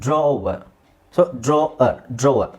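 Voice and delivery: ಡ್ರಾವರ್ as draw-uh, little w; slow model of ಡ್ರಾವರ್ without a firm "war"; weakened ending. Shown as a demonstration. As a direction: stretch "draw" in ಡ್ರಾವರ್ and let the r drop. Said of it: "ವರ್ ಅಂತಲೂ use ಮಾಡೋದಿಲ್ಲ".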